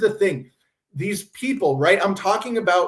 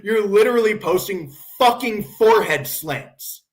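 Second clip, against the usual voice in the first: usual speaking voice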